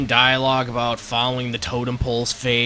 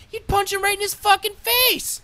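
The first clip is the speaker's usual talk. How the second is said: high, shrill-pitched comic outrage; indignant outburst; 4 dB louder than usual. Real, not acted. Reviewer imagining the doctor, high comic outrage. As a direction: spike it way up in mock fury.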